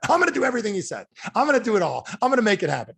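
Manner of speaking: high, quick, hammed-up eagerness; excited pledge. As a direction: mimic a fired-up attendee making promises. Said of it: "if you're excited tonight".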